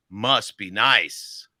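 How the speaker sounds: very slow, each word stretched, must be nice; slow drawn out. Shown as a demonstration. Coach demos a slow drawn-out must be nice. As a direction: drag "Must be nice" out slowly. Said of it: "Here's my voice coaching".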